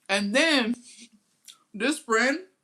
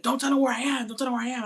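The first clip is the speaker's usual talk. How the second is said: pleading friend; 7 semitones higher, faster than usual. Retold or imitated. Friend begging not to be found.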